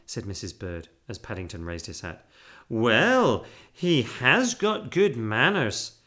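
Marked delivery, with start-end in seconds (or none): 2.7-6.0 loud, slow, emphatic approval; stern-but-fair housekeeper. A narrator's reading. Housekeeper approving bear's manners, grudging. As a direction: concede it firmly, drawn out. Mrs Bird voiced in story.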